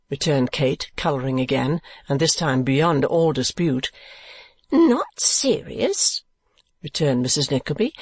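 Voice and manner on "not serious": high, very drawn-out disbelief; incredulous echo. A narrator's reading. Mother echoing her daughter's doubt, incredulous. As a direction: stretch it out high, as a question.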